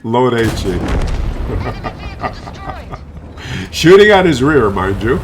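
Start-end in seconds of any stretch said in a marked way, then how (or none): 0.8-3.7 long, soft chuckle; amused laugh. Real, not acted. Player mocking enemy shooting HE, amused laugh. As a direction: laugh quietly and at length.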